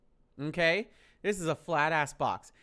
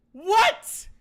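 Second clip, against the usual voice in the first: sudden very high, loud yelp; shocked what; 14 semitones higher, 10 dB louder than usual. Retold or imitated. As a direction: blurt it out, floored. Shocked reaction to friend's answer.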